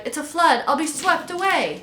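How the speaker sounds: high-pitched cry of alarm. A narrator's reading. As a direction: cry out as the water rises, voice up high. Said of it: "he cried".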